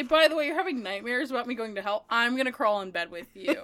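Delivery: fast, offhand mock quote. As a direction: rattle it off mockingly.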